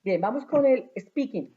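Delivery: ordinary talk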